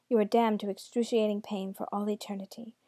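ordinary delivery

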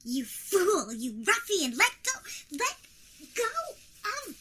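loud, furious, struggling to break free; angry struggling. Acted. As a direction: fight off the grip, furious. Struggling in the wizard's grip.

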